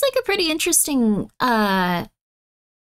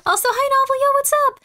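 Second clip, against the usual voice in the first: excited greeting; 12 semitones higher, faster than usual. Real, not acted.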